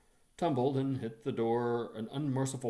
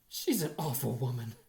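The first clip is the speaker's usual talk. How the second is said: self-pitying; 6 dB louder than usual. A narrator's reading. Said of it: "very sorry for myself".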